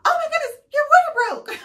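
high, loud, shocked cry; shocked nurse. Retold or imitated. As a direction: exclaim it in alarm, way up high. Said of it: "And she's like".

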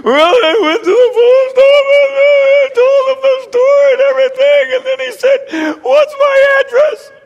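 very high, loud wailing through tears; imitated hysterical daughter sobbing down the phone; mock weeping. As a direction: bawl the story out, hammed-up. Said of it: "She's just weeping on the phone".

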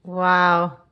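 usual speaking voice

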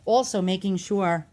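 usual speaking voice

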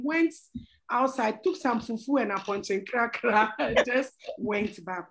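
normal talking voice

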